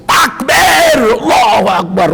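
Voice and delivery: imitating a preacher yelling the takbir; high, loud mock shout of takbir. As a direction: bellow it like an over-excited khotib. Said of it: "itu teriak".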